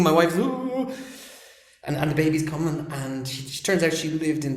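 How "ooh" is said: long high "ooh"; mock birthing moan, pitched way up; imitated labour cry. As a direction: mimic a wife in labour. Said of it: "she's doing the thing".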